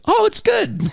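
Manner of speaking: very high-pitched delighted squeal, put on; pleasant surprise. Cult movie turning out fine, high mock-delighted voice. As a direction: jump way up in mock relief. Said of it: "and it goes".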